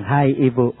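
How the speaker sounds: very low, slow, measured voice; low solemn pronouncement; Jesus. Retold or imitated. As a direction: speak deep and unhurried, bestowing a blessing. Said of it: "Berhenti dan bilang".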